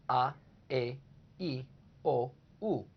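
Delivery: each vowel a, e, i, o, u said crisp and separate; Spanish vowels spoken clearly. Shown as a demonstration. Teacher reciting the Spanish vowels, a, e, i, o, u, crisply. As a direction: lay out a, e, i, o, u one by one. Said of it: "the five vowels in Spanish".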